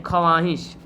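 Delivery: ख्वाहिश split as "kha-waahish"; read as written. Shown as a demonstration. Teacher repeating the spelling, ख्वाहिश with audible व. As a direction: make the व in ख्वाहिश heard. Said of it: "क्या लिखते हैं?".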